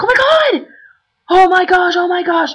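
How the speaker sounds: high, loud excited squealing. Retold or imitated. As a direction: gush like a starstruck fan. Friend spots [name].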